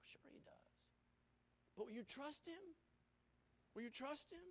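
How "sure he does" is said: whispered reassurance. Real, not acted. Preacher whispering assurance to the friend. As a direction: breathe it out gently. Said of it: "whispered aside".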